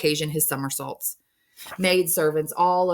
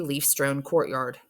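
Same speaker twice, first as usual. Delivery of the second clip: plain reading voice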